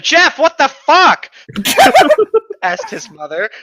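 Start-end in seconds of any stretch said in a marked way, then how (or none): 0.0-1.3 high, loud, slow startled cry; a mother catching her son, mock shocked; shocked outburst. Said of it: "Opposed to". 1.5-2.6 loud high cackle; high cackling laugh; burst of high laughter. Real, not acted. Laughing after own outburst.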